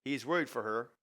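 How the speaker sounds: normal talking voice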